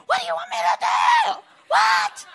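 very high, loud exasperated shout; mock scream. Preacher showing how Jesus did not scream at disciples, high mock shout. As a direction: yell it back in annoyed exasperation. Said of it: "he didn't scream and say".

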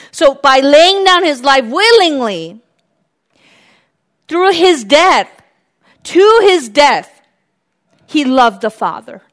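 slowed, emphatic preaching; preaching at full force. Real, not acted. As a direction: preach it with rising fire. Preaching climax.

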